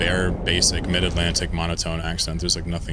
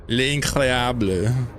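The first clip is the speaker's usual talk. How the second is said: put-on French accent; 5 semitones higher, much slower than usual. Mock French exclamation.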